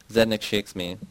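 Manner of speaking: plain reading voice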